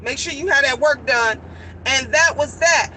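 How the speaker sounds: hurt. Real, not acted. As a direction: relive the letdown. Mother's cold reply recalled.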